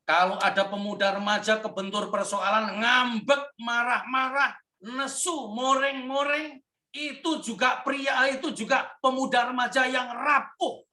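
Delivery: rising, fervent preacher build; preaching at full force. Acted.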